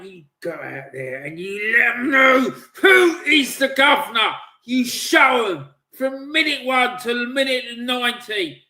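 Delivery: building to a loud, high roar; rallying shout. Real, not acted. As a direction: roar them onto the pitch. Fired-up pep talk to players.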